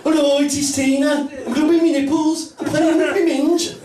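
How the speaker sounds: high mock Birmingham girlfriend talking dirty; Birmingham accent.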